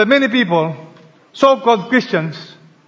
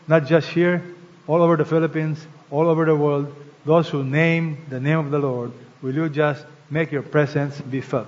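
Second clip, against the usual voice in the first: soft, low, earnest praying voice; quiet prayer; 3 semitones lower, 3 dB quieter than usual. Acted. Pastor praying over the church, soft and earnest. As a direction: pray over the congregation, hushed and sincere.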